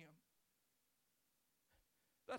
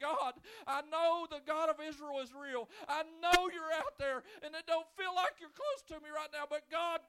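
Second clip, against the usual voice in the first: loud, high, breaking plea for help; a man under a fig tree crying out for God's help; 4 semitones higher, 8 dB louder than usual. Retold or imitated. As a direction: cry out desperately to God for help. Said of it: "looking up to heaven and saying".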